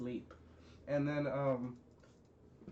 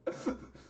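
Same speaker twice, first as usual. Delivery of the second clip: helpless wordless giggling; real laugh. Real, not acted. Storyteller cracks up at own mistake, real laughing. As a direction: dissolve into laughter.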